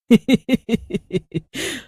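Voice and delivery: high eerie cackling; witch cackle. A narrator's reading. Witch cackling at the new victim. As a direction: cackle in triumph. Witch laughing mid-speech.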